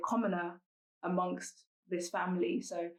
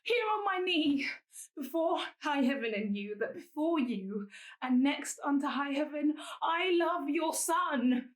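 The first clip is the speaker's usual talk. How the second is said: higher-pitched, pleading dramatic verse with weighted pauses; Helena, lovelorn confession; 6 semitones higher than usual. Acted. Commoner confessing love to the madam whose son she loves, pleading.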